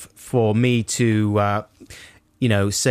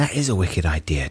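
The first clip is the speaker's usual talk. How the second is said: whispered agreement; 3 semitones lower than usual. Real, not acted. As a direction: breathe it conspiratorially.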